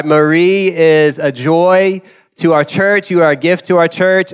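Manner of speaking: usual speaking voice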